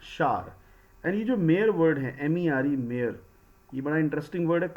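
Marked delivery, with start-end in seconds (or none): 0.1-0.5 shower pronounced "shaar", with no w sound; one-syllable shower. Shown as a demonstration. Teacher shows today's pronunciation, shower said as "shaar". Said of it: "Now this has become".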